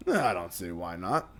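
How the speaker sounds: easygoing low pirate reply; low slow pirate. Acted.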